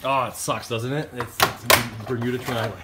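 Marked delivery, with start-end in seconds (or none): none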